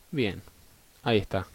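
ordinary talk

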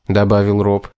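ordinary delivery

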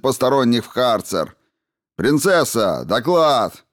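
high, loud barked commands; shouting drill sergeant. Acted. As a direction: bark orders at full lung power. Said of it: "гаркнул на пределе возможностей лёгких и связок". (командирский крик на пределе связок)

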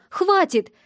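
high-pitched cry to stop; sharp outcry. Acted. Sister stopping the man from telling her brother, sharp. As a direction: cut him off urgently. Sister lunging at him in a quarrel.

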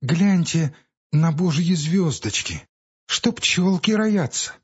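put-on slow, high little boy in wonder; a small child marvelling at stars; small child's voice. A narrator's reading. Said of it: "детский голос Вани".

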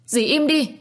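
high, loud demand for silence; angry outburst. A narrator's reading. Niece silences her slandering aunt, angry outburst. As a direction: cry out to shut the accuser up.

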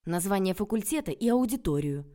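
loud, indignant reproach; angry scolding. Acted. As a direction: tear into him. Heroine scolding Petya. (громкий упрёк)

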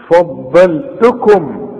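فضلتكم very slow, with each letter clear; correct reading. Shown as a demonstration. Teacher gives the correct reading after a wrong one. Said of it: "الف في القرآن فا".

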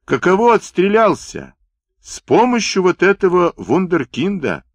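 high-pitched hail to a comrade; calling from afar. A narrator's reading. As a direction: shout over playfully, pitch well up. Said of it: "кричал издали". (высокий оклик издали)

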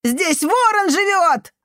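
put-on very high, drawn-out child's cry; desperate cry. A narrator's reading. Boy trying to show the janitor the raven's place, desperate. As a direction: insist desperately in a boy's voice.

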